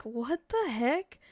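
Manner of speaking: slow, drawn-out mock bafflement; baffled disbelief. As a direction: imitate her slowly stretched-out disbelief. Quoting her baffled reaction.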